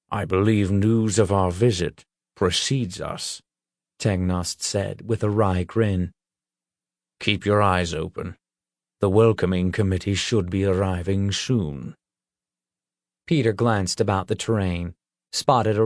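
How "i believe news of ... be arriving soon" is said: a lightly voiced, wry old troll; gruff troll. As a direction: give it a dry, knowing troll voice. Troll Tangnost speaks.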